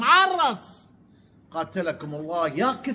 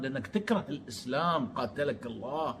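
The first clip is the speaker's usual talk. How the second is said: low, much softer curse under the breath; muttered curse; 6 semitones lower, 12 dB quieter than usual. Real, not acted. Vlogger cursing Amr under his breath, muttered. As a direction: hiss the insult half to himself.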